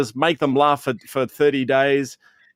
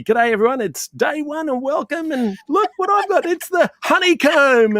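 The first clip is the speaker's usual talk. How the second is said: much higher, hammed-up host greeting; cheery stream host; 13 semitones higher than usual. Greeting viewers on his daily advent stream, much higher hammed-up host. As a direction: play the over-the-top showman opening each day's reveal. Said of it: "And every day would be".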